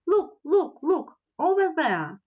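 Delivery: high, repeated exclamations; excited shout. A narrator's reading. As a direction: point out a sight with mock-shouted urgency. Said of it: "Teddy shouted".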